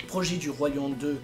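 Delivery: ordinary delivery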